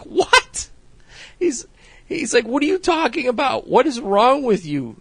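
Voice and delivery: high-pitched laughing disbelief; incredulous. Retold or imitated. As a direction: squeal it out in disbelief. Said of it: "he's just like".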